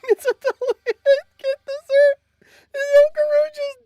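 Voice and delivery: high, loud disbelieving cry; stunned shriek. Real, not acted. Streamer shrieking at a character's death.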